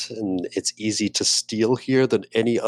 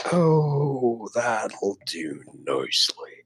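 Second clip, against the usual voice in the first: slow contented purr; much slower than usual. Acted. Character savoring drink.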